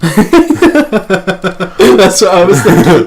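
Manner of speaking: words through laughter; laughing. Real, not acted. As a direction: giggle while saying it. Laughing reply.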